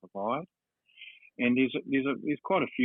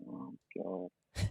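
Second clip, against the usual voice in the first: groaning aside; 4 semitones lower, 10 dB quieter than usual. Retold or imitated. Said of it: "And I'm going".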